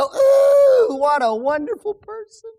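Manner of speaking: a high, loud gushing "Ooh" then easing down; imitating a crowd swooning over smooth talk; mock fawning admirer. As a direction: gush over a flatterer in mockery. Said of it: "individuals just go".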